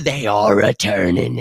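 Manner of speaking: slow satisfied repeat. Real, not acted. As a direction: savour each word.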